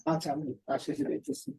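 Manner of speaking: hushed voice on a call; quietly answering the phone mid-class; whispering on the phone. Real, not acted. Teacher takes phone call in class.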